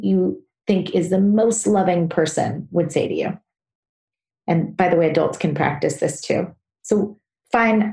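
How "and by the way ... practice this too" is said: ordinary talk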